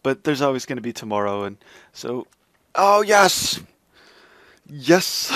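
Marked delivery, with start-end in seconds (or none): 2.7-5.3 loud joyful yes; excited cheer. Real, not acted. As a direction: shout for joy at success. Quest succeeded.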